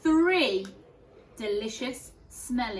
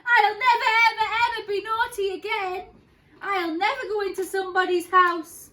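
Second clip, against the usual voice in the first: much higher, loud tearful pleading; crying promise; 11 semitones higher, 9 dB louder, faster than usual. Acted. Goldilocks running home, high loud tearful vow. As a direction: wail it as you run.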